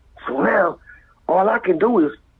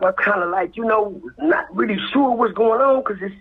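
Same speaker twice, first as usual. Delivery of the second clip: usual speaking voice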